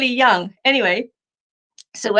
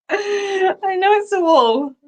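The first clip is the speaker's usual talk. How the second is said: much higher and slower, breaking up laughing; laughing hard; 9 semitones higher, much slower than usual. Real, not acted. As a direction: squeal it through helpless laughter. Reading a funny comment.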